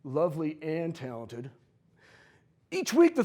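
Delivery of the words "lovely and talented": softer, doubtful repeat after a long pause; skeptical re-read. Real, not acted. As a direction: let the words hang with suspicion. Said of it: "Hang on a second".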